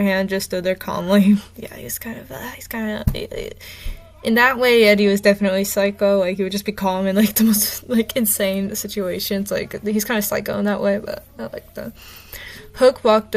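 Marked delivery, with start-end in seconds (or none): 1.6-3.0 breathy whispered aside after a laugh; giggly whispered aside. Real, not acted. Aside under her breath. 11.0-11.9 soft unvoiced whisper of approval; whispered little admission; whispered aside. Real, not acted. Aside under her breath.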